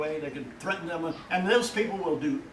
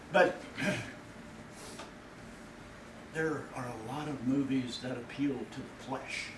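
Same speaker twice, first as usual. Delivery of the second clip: ordinary talk, nothing marked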